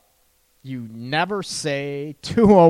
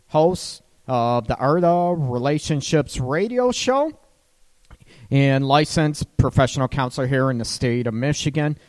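ordinary talk, nothing marked

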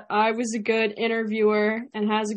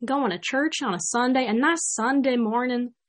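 country accent; 3 dB louder than usual. Shown as a demonstration. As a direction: demonstrate a folksy Southern country accent. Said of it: "add some country to it".

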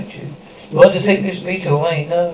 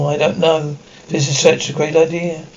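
ordinary talk

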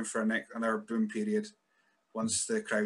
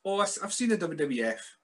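higher, dated fan voice; mock old relative; 6 semitones higher than usual. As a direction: play the out-of-touch uncle. Imitating older relative.

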